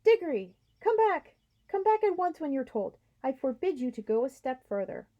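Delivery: half-hearted shout. Acted. Uncle Andrew calling Diggory back, a timid shout. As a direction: yell after him without real courage. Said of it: "shouting, but by no means at the top of his voice".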